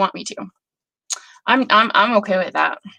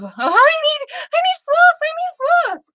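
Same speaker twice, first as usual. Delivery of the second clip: high, frantic mock panic, much higher. Imitating a panicked stitcher needing floss, high mock panic. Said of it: "that it wasn't a panic of".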